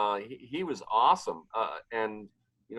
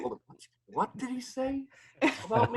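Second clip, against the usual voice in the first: high, quiet, puzzled muttering; a startled double take, high and hushed; baffled high voice; 10 semitones higher, 5 dB quieter than usual. Retold or imitated. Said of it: "'cause I'm like".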